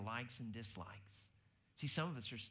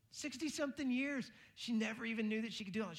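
amazed; 11 semitones higher, 3 dB louder than usual. Real, not acted.

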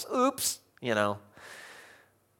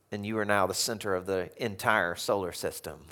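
ordinary talk

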